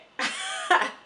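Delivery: a burst of high, loud laughter. Real, not acted. Laughing at her own twin joke, high and loud.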